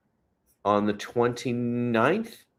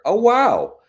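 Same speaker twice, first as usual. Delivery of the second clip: high, loud burst of surprise; a big wow at startling news; amazed; 8 dB louder than usual. Real, not acted. Checking the local temperature, amazed.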